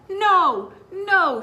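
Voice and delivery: loud, high shout of protest; a kid yelling to stop them; desperate child's shout. Acted. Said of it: "shouted Sid".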